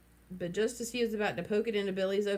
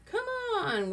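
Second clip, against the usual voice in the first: usual speaking voice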